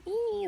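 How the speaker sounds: a high imitated squeal; mock squeal. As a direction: squeal at a child, half mocking. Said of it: "squealing at the kid".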